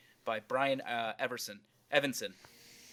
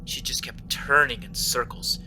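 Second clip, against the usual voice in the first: even, measured horror story read; story narration; 6 semitones lower than usual. Acted.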